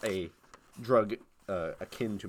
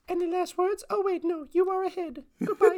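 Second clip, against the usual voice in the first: very high mock British queen accent, deadpan; a hammed-up high British queen voice. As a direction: deliver the sentence like a falsetto queen. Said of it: "terrible British accent".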